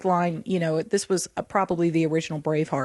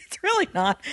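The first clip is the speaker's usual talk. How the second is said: laughing through the words, half-apologetic; can't stop giggling while she says it; 10 semitones higher than usual. Real, not acted. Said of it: "I don't know why I'm laughing".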